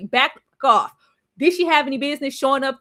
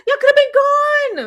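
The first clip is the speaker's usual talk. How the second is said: high exasperated; 13 semitones higher, 5 dB louder than usual. Real, not acted. Rueful repeat of a missed chance.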